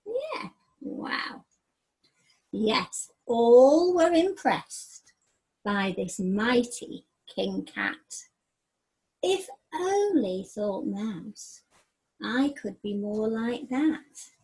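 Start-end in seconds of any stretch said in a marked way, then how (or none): 2.5-14.3 slow, emphatic read-aloud with a wistful close; story narration. A narrator's reading.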